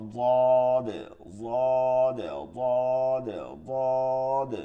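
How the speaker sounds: "দদ" long-held, thick and low; low drawn-out rounded "দদ"; thick soft dwad. Shown as a demonstration. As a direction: stretch "দদ" on one low, heavy note. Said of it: "মোটা এবং নরম".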